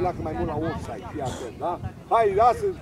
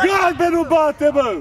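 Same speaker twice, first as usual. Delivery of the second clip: high, loud, drawn-out yell; urgent shout; 7 semitones higher, 9 dB louder, slower than usual. Real, not acted. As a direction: shout it across the pitch. Coach shouting at players. (strigăt puternic, prelung)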